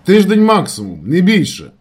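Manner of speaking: loud, high assurance; shouted reply. A narrator's reading. Narrator promising a week at most, shouting. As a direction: yell the answer back. Shouted phone answer about delay.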